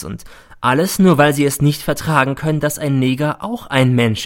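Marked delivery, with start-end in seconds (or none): none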